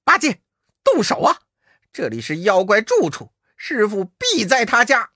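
提高嗓门催八戒动手; 冲八戒急喊; 高声喊. Acted. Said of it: "悟空叫". (大声催促)

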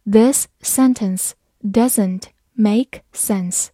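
very slow, careful read-aloud. Shown as a demonstration. Teacher reading slowly for learners. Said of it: "好，慢慢来读。".

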